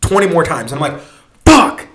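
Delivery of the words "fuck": loud, high acted curse; mock-agonized yell of defeat; frustrated outburst. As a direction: burst out with exasperation. Retold frustration, passing shop twenty times.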